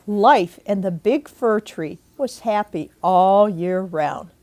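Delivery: ordinary delivery, nothing marked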